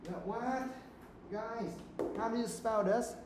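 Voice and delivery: high-pitched, exasperated teacher; dismayed disbelief. Real, not acted. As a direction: appeal to the class in high disbelief. Teacher dismayed at class's spelling.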